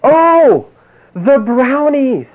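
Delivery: high, slow dawning "Oh, the brownies"; the penny drops, stretch it out in wonder; mock amazed discovery; sudden realization. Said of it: "and he went".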